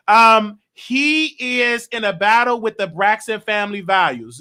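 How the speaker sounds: ordinary talk, nothing marked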